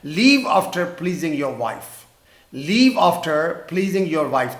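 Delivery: very slow, word by word; slow dictation. Shown as a demonstration. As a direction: dictate slowly for the class. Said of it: "بیگم کو خوش کر کے جانا".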